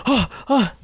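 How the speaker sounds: high mock gasps; imitating shock. Classmate stunned by the prank story, imitated shock. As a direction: act out stunned little gasps. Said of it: "He was, like, shocked".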